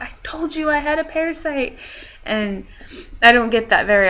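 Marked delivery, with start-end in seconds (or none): 0.0-1.8 vindicated Jake. Retold or imitated. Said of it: "he's like".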